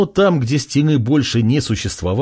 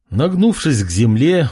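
ordinary delivery, nothing marked

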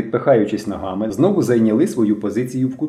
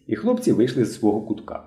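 ordinary delivery

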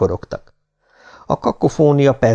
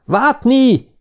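high cry of the name, moderately raised; alarmed shout. A narrator's reading. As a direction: call out to a crewmate in trouble. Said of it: "kiáltott Johansen". (riadt, magas hangú kiáltás)

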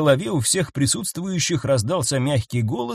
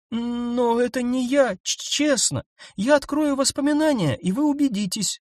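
frightened creature pleading; 10 semitones higher than usual. A narrator's reading. Nyasha timidly pleads her innocence to the group. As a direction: beg them to believe you.

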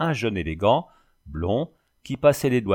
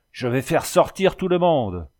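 higher, sharp angry exclamation; angry outburst; 6 semitones higher, 4 dB louder than usual. A narrator's reading. Pale with rage, the judge threatens to clear the court, shouting. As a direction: threaten the laughing crowd in fury. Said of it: "blême de colère, s'écria".

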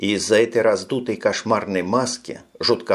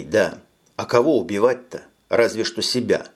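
usual speaking voice

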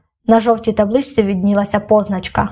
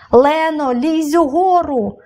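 higher, slow urgent shout; 5 semitones higher, slower than usual. A narrator's reading. Boy shouting down to Lena on the ledge, slow urgent yell. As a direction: yell it down the cliff, stretching угору. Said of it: "крикнув я".

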